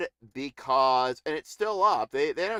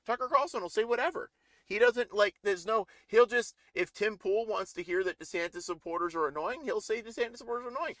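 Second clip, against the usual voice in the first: ordinary talk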